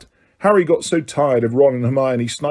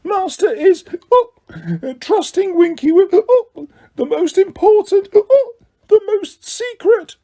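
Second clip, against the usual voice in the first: high, slow, weepy elf voice; Winky tearfully boasting of her master's trust; drunk tearful elf; slower than usual. A narrator's reading. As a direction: slur out the secret like a tipsy elf. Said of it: "drunk Winky boasting tearfully".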